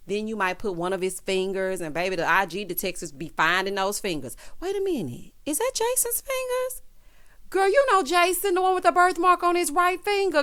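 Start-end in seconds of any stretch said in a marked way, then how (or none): 4.6-10.4 high, gasping Instagram sleuth; nosy gossip voice. Pretend. Commenters catching a man's fingers online, high and gasping. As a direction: play the nosy fan who just spotted him.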